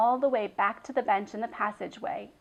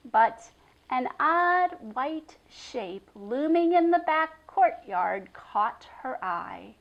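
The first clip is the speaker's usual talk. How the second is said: ordinary delivery, nothing marked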